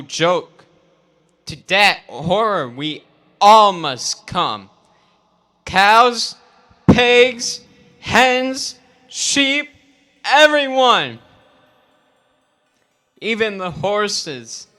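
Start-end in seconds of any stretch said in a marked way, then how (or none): 5.7-11.2 rising rally list. Acted. List building in rally speech.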